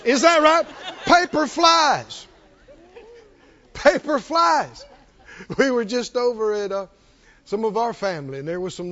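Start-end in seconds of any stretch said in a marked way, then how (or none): 1.0-4.8 high, drawn-out exclamation; excited outburst. Shown as a demonstration. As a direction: stretch each word for the crowd. Children ripping open presents, acted out.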